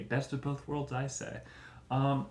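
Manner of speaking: ordinary talk, nothing marked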